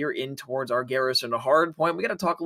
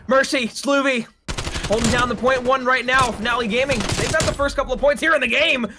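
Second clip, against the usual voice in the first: raised, energetic esports commentary; live play-by-play; 5 semitones higher, 3 dB louder than usual. Real, not acted. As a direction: call the match with driving energy.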